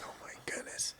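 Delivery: hushed, whispered disbelief; barely audible, imitating a stunned fan; awed whisper. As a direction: whisper it to yourself, starstruck. Awed fan's inner reaction, retold.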